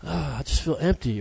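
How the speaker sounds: quiet, mock-dejected whine; mopey complaint. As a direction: sigh it out like someone empty inside. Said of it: "we always feel like".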